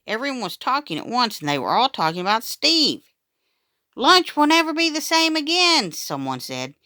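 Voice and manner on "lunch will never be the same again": put-on excited kid. A narrator's reading. Excited kids praise Steve.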